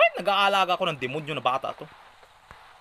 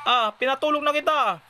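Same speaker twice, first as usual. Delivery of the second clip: higher mock-scolding whine; exasperated scolding; 12 semitones higher, 4 dB louder than usual. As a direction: pretend to scold the naughty baby. Scolding baby out of bed.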